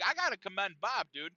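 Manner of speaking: usual speaking voice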